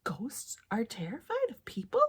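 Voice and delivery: hushed, shocked whisper; awed whisper. Acted. Reader whispering in awe to the children. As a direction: breathe it like a scary secret.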